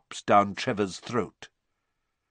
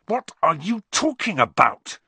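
high, sharp demand; angry; 9 semitones higher, 5 dB louder than usual. Acted. Harry demanding Malfoy explain, sharp and angry. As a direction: demand it angrily. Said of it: "said Harry angrily".

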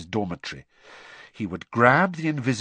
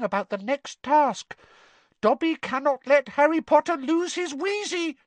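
squeaky house elf; 14 semitones higher than usual. Acted. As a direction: squeak it out urgently. Dobby voiced.

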